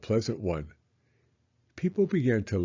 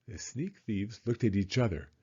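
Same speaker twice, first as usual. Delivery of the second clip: plain reading voice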